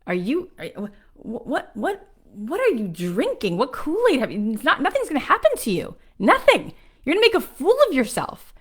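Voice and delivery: high, incredulous retold scolding, sputtering then building; angry mother. Mom to daughter leaving for L A, angry and disbelieving. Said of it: "She was so angry at me".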